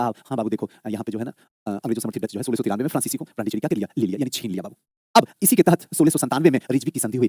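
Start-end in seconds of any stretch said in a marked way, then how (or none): 0.0-4.8 softer, droning imitation of a sluggish teacher; mock a sleepy, listless lecturer; dull lazy teacher. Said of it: "एकदम सुस्त teacher की तरह". (सुस्त teacher की धीमी नकल)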